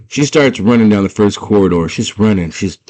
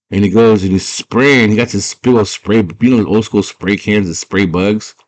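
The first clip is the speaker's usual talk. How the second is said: ordinary delivery, nothing marked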